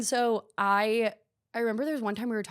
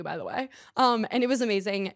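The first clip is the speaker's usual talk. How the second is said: ordinary talk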